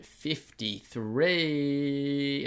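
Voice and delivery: upbeat host intro, slowing on the number; podcast intro. Acted. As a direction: announce the show like a host.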